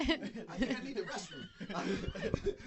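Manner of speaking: high, slow squeaky excuse with a laugh; sneaky escape. Retold or imitated. Dad imitating himself dodging a family argument, high and slow. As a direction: wriggle out of the fight in a tiny voice. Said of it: "I'm like".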